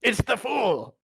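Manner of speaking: high, excited in-character cry. Acted. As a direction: call out as your character. Player voices character.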